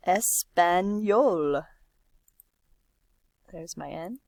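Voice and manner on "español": "español" drawn out slowly, syllable by syllable; slow pronunciation demo. Shown as a demonstration. Teacher modeling Spanish word slowly for students. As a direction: stretch "español" out for the class. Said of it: "So es".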